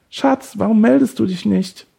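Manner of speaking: mocking, very high put-on voice; high desperate imitation. Kidnapper mimics the boyfriend's message, high and desperate. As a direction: mimic a desperate partner in falsetto. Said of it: "verstellte seine Stimme ganz hell und verzweifelt".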